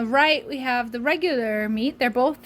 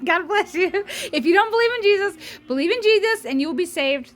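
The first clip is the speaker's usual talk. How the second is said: laughing, higher mock sermon; mock preacher; 7 semitones higher than usual. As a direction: ham up a preacher's blessing. Jokey sign-off sermon.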